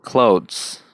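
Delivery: क्लोथ्स with an American accent; American English clothes. Shown as a demonstration. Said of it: "American में".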